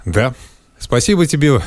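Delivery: ordinary talk, nothing marked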